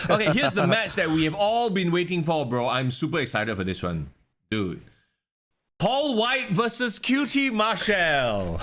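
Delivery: oversell it with fake excitement; sarcastically hyped ring-announcer build-up; sarcastic hype. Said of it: "I'm being sarcastic".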